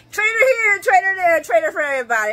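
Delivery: loud, high mock wail of traitor; mock shout. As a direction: yell it like a whining protest. Mocking the traitor accusations.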